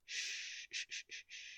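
soft unvoiced shushing; calm him with gentle shh sounds; soothing a frightened boy, softly shushing; hushing. Hushing the scared listener.

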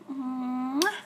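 a secret air kiss; whispered kiss. Acted. Girlfriend whispers a kiss to her faraway boyfriend. Blowing kisses to distant partner.

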